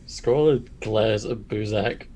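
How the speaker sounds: normal talking voice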